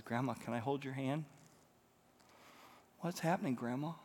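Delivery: soft, hesitant question from a little granddaughter; lightly voiced small child. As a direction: gently suggest a concerned little child. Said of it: "Little three-year-old granddaughter's never seen Grandma like this".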